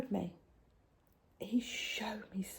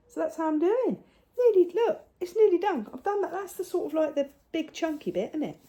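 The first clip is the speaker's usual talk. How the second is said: higher, brighter showing off; excited; 9 semitones higher, 4 dB louder than usual. Real, not acted. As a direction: proudly show how nearly done it is.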